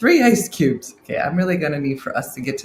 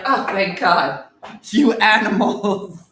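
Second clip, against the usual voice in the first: loud mock exasperation, ending drawn out; hammed-up outcry at clueless plant owners; [name], emphatic; 3 semitones higher, 7 dB louder, slower than usual. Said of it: "so emphatically as [name] just did".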